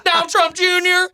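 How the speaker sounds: very high, slow, laughing bro; high mock bro. A laughing fan to the comic, very high and slow. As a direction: stretch it out, high and goofy.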